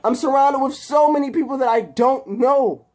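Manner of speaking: high, loud, desperate rant; agitated outburst. Acted. Harry confronting captive Peter about their friendship, agitated outburst. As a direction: build to a high, pleading shout.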